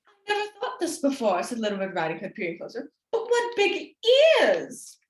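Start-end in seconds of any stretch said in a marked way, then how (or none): 0.1-1.4 put-on girl's voice. Girl peering closer. 3.1-5.0 put-on girl's wonder. Girl peering closer.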